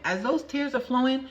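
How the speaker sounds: usual speaking voice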